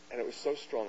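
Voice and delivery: ordinary delivery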